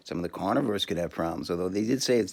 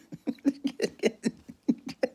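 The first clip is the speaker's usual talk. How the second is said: high giggle. Real, not acted. Cracking up mid-rant.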